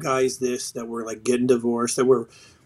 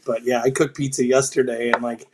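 normal talking voice

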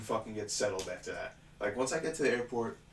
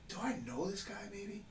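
high, soft, puzzled question; high wondering; 9 semitones higher, 11 dB quieter than usual. Retold or imitated. Wondering about the stranger, high and quiet. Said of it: "I'm like".

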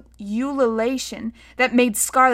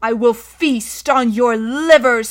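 loud, slow monster threat; full-voiced, drawn-out villain cry; menacing scream; 8 dB louder, slower than usual. A narrator's reading. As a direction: roar it, stretching every word. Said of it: "screamed the Indigo Man".

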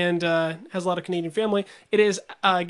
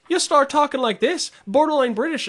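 put-on higher, borderline British Canadian accent; teasing Canadian accent impression; mock Canadian accent; 6 semitones higher, 4 dB louder than usual. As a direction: exaggerate a Canadian lilt, nearly British. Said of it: "when you gather with other Canadians".

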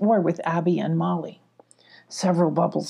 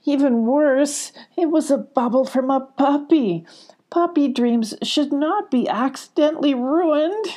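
upset fairy Sparkle; 7 semitones higher than usual. A narrator's reading. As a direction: fret over the ruined puppy dream. Sparkle voiced in the story.

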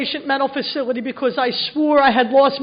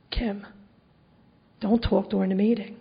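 hushed; 5 semitones lower, 9 dB quieter than usual. Retold or imitated. Dad hushing her again.

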